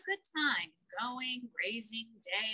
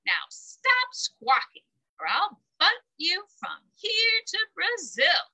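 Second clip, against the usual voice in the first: menacing bully; 5 dB louder than usual. Acted. Bully goat threatening ogre.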